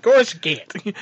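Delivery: "Course I can't" echoed high, broad A in can't; mimicked Iowa A. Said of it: "you threw the Iowa A into it".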